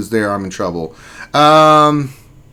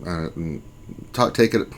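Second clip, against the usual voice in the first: normal talking voice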